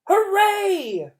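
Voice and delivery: high, loud joyful cry; cheer. A narrator's reading. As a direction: shout it out with delight. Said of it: "cried a voice from the audience".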